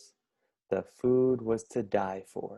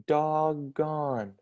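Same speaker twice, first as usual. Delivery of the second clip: ordinary delivery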